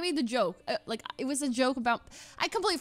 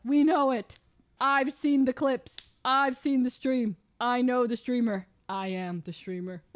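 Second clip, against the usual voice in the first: mock know-it-all; 5 dB louder than usual. Mocking know-it-all viewers.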